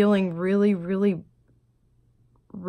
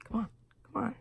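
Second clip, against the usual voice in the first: urging whisper, retold; man whispering to his brother to come over; 4 semitones higher, 10 dB quieter, faster than usual. Brother whispering to join in. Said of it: "He's like".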